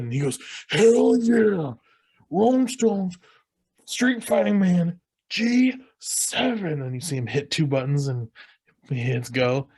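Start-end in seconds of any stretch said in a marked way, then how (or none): 0.7-6.8 high, drawn-out mock yell; whooping out each word, pitched way up; hyped-up character. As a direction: imitate a rowdy guy thrilled to pick the song. Retold brawl jukebox pick.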